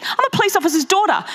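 ordinary delivery

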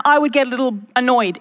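ordinary talk

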